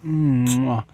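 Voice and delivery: the sun showers her with loud kisses and purrs; the sun kissing her. Pretend. Sun croons over the woman by the pool, loud and kissy. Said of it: "The sun is like".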